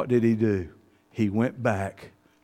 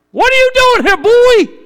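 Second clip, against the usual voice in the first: loud, very high thundering call; booming voice of God; 16 dB louder than usual. Pretend. God confronts Elijah hiding in the cave, booming and high. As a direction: call down from heaven, demanding. A still small voice.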